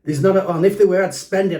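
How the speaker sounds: mock a smug, know-it-all reply; cocky and arrogant. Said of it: "really cocky and arrogant".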